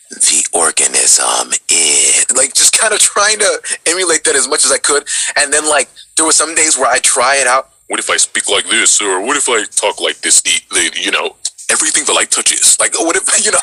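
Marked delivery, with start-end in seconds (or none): 0.1-2.3 very low, very slow put-on voice; a teen hamming up a booming movie voice; terrible James Earl Jones. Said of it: "how did your James Earl Jones sound? It was terrible". 7.9-12.8 trying out voices. Retold or imitated. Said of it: "I just try to mess around with it".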